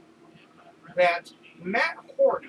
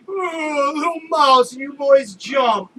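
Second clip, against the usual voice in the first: high, loud teasing mock cry; mock the boys in a high whiny voice; mocking boss; 7 semitones higher, 10 dB louder, slower than usual. Boss teasing the jumpy workers, high mocking voice. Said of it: "And he goes".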